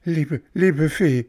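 high, urgent begging; imploring puppet boy; pleading. Acted. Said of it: "flehte Bengele".

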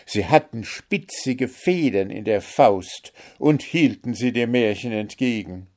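usual speaking voice

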